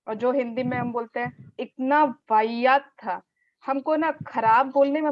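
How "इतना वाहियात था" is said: slow, louder stress on "इतना"; a slow, louder "इतना वाहियात था" with a stressed "इतना"; forceful disgust. Shown as a demonstration. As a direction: spit out "वाहियात" with contempt. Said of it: "बल देते हैं उस शब्द को".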